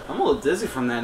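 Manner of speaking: ordinary delivery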